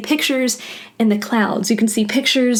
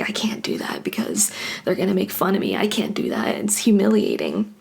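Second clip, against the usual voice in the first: whispered, anxious inner voice; fearful whisper; 3 semitones lower, 5 dB quieter than usual. Retold or imitated. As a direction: hush it like a secret shame. Voicing a fearful inner thought.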